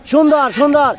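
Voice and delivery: high, rising cheer; excited commentary. Real, not acted. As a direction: cheer the play on. Commentator reacting to play.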